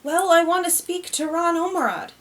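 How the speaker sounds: impatient widow, a touch testy. A narrator's reading. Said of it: "a little testily".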